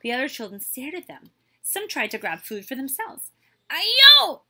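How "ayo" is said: very high, loud yelp; yelped outcry. A narrator's reading. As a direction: yelp as if burnt. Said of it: "they yelped".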